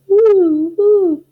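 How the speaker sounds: high loud owl hoot; hollered hoots. Acted. Outraged owl hollering at the sneeze, loud hoot. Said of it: "who hollered".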